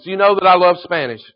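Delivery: usual speaking voice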